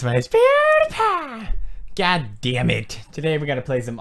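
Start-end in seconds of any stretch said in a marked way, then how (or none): 0.0-1.5 high squeaky intro, far above his normal voice; much higher cartoon intro voice; signature intro voice. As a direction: pitch it way up like a mascot announcing himself. Signature channel intro greeting.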